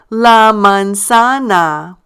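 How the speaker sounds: "la manzana" very slowly, stress on ZA; stressed syllable. Shown as a demonstration. Teacher asking learners to say it with her, slow stressed "ZA" in "manzana". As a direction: break "la manzana" into drawn-out syllables, leaning on ZA. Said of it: "notice I stress the syllable".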